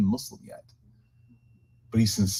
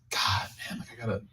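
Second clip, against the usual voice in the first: whispered grumble under the breath; annoyed whisper; 10 dB quieter than usual. Retold or imitated. Imam grumbling about taking headphones off, whispered. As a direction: mutter it so the neighbour can't hear. Passenger annoyed at chatty neighbour.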